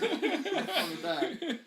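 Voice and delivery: slow words through laughter; laughing. Retold or imitated. Joke parent voice, laughing.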